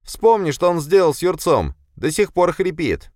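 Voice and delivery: high, loud panicky plea; urgent, scared warning; frightened shout. A narrator's reading. Scared guard pleading.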